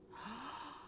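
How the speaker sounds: high startled intake of breath; sharp shocked gasp. Real, not acted. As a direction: gasp in real alarm. Board says Mark dies today.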